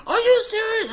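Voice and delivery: high, loud, tearful are you serious; crying disbelief. Retold or imitated. Mom hearing of brain surgery, crying loud. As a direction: mom sobbing out the question.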